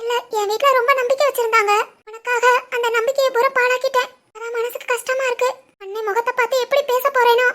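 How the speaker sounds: soft, sorrowful confession; quietly tearful. Acted. Young woman confessing she broke her family's trust, quietly tearful. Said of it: "ஏன் அழுகுற? அழாத".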